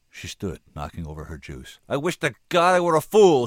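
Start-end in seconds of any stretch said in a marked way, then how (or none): 1.9-3.5 loud, high outburst; anguished cry. A narrator's reading. Woman losing control before the senator, crying out. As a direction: cry out as control begins to crack. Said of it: "she cried, feeling her control begin to crack".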